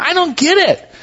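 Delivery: high nasal twang, baffled; Festus twang impression. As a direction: Festus shaking his head, through the nose. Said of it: "he kinda talks through his nose".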